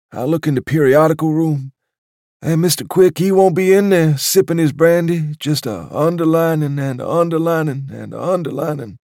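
put-on a doddering old waiter picturing the club without him; old waiter, wistful. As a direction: list each place like a mournful litany. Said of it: "as though telling a ghost story".

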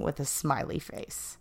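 unvoiced, breathy aside; whispered aside. Real, not acted. Vlogger whispering that the message ended with a smiley. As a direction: whisper it like a side note.

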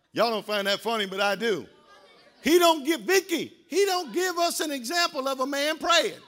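usual speaking voice

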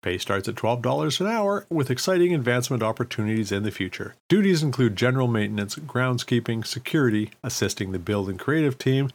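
ordinary talk, nothing marked

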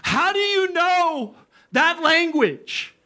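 high, drawn-out cry of disbelief; stunned, high-pitched outcry; astonished cry. Retold or imitated. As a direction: cry it out slowly, stretching each word high. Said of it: "he cries and goes".

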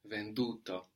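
ven-DU-to, stressed on the middle syllable; accent on uto. Shown as a demonstration. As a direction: stress the DU in "venduto". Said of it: "still have the accent there on uto".

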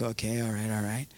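soft, low, calm acknowledgement; patient listening. Retold or imitated. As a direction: quietly let them vent, voice dropped. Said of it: "very patient God".

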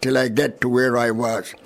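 usual speaking voice

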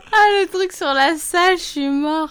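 high, loud helpless laughter; laughing hard. Real, not acted. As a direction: crack up while speaking. Cracking up at the review.

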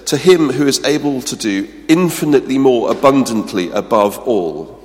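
"him" high and loud, "above all" slowed; full emphasis. Shown as a demonstration. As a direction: pile on every word of excess. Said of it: "how much emphasis he's putting".

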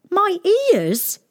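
put-on high astonished boy; shocked, pitch jumping. A narrator's reading. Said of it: "he said, astonished".

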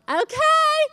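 squealing overexcited encouragement; obnoxious cheering parent. Retold or imitated. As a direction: hype up a baby over the top. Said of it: "obnoxious, extra, extra".

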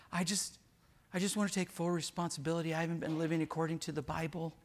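soft, quick, meek voice; mock wife confession. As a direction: confess it softly and fast like a sheepish wife. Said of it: "the wife raised her hand and said".

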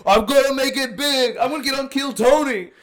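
high, loud, cartoonish boast; mock dreamer. Mocking van-living comedians' big dreams, high and hammed up. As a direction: ham up a naive wannabe comedian.

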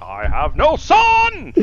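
slow, loud declaration; hammed-up father; dramatic disowning. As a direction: proclaim it like a tragedy. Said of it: "echo of the line".

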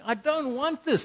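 high, pleading protest through tears; retold tearful protest. A preacher retelling his tearful plea to God, high and pleading. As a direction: beg God to take it away. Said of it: "I'm weeping and I'm going".

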